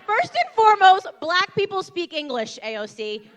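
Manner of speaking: loud, high-pitched lecture in mock outrage; exasperated retort. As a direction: scold her loudly, like setting a politician straight. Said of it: "I'm like".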